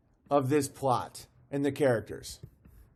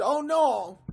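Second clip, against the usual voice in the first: higher, mock-frightened little cry; imitating the scared guy; 9 semitones higher than usual. Mocking the frightened little guy from the clip, high and scared. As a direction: squeak it out like the timid guy would. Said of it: "He's just".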